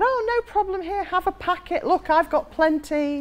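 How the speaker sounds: high-pitched, breezy generosity; chirpy young woman. Retold or imitated. Young woman offering a stranger cigarettes, chirpy and high. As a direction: play an eager, friendly stranger.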